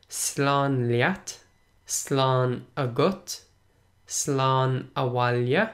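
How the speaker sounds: slán leat, slán agat, slán abhaile said slowly, each word spelled out in sound; slow, careful teacher read of each Irish goodbye; slow Irish demo. Shown as a demonstration. Teacher slowly modelling Irish goodbyes for a learner, careful and clear. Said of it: "a couple of different ways to say goodbye in Irish".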